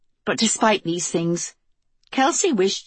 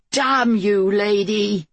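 restrained shout; 3 semitones higher, slower than usual. A narrator's reading. Pen calling to the Queen across the broken bridge, restrained shout. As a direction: call it out as an angry curse. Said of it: "Pen shouted".

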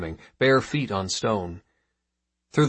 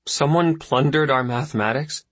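put-on boy's voice; 6 semitones higher, 4 dB louder than usual. Boy questions his father.

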